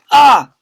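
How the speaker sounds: cartoon yelp; pained cry. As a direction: yelp as you're bowled over. Children knocked out of way.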